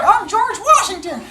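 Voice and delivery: put-on high proud kid voice with a laugh; silly kid. Teacher voices Ricardo standing on his chair, high proud kid. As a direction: proclaim it like a goofy child. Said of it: "and he was like".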